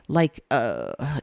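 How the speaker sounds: ordinary delivery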